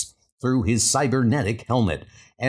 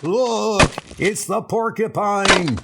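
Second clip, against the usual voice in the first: a bank customer spotting the villain, alarmed shout; 8 semitones higher, slower than usual. Acted. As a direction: point and yell in fright. Bystander crying out in alarm.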